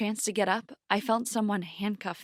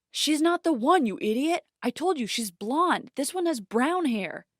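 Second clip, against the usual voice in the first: higher, exasperated scolding; scolding partner; 6 semitones higher, 3 dB louder than usual. A narrator's reading. Young man scolding his friend for the mistake, exasperated. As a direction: chew out your friend. Scolding accomplice behind door.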